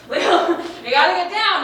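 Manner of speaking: high, loud desperate plea; laughing panic. Retold or imitated. Panicking kid begging her friend, high and laughing. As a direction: beg through nervous laughter. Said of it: "I'm like".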